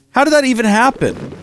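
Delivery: can't believe what you're seeing; exasperated how-is-this-possible; baffled disbelief. Real, not acted. Bees escaped, baffled.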